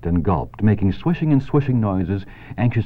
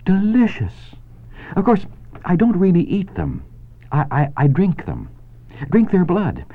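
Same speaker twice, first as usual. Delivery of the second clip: much higher, thin and sweetly pleasant; 11 semitones higher than usual. Acted. Charlotte telling Wilbur she drinks blood, thin and sweet. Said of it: "her pleasant, thin voice grew even thinner and more pleasant".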